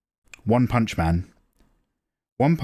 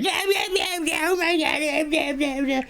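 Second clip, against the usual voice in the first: very high-pitched mock VTuber babble; cartoonish imitation of a screechy streamer; screaming VTuber impression. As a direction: squeal like an over-excited streamer, mockingly. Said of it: "constantly screaming in camera going".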